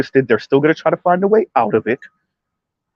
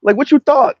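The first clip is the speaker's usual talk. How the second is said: much higher, giggly squeak; high-pitched goofy voice, joking with his lady; high silly voice; 11 semitones higher than usual. Retold or imitated. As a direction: squeak it out like a goofball. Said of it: "I was like".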